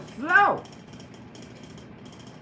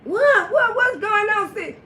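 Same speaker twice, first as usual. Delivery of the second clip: calling out higher in a panic; hollering for sister; 7 semitones higher, slower than usual. Retold or imitated. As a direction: holler for the sister across the house. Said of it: "He went to hollering for his sister".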